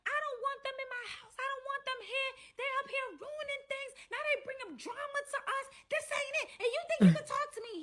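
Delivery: very high-pitched mock tantrum; shrill angry rant. Side girl ranting about unwanted guests, shrill mock anger.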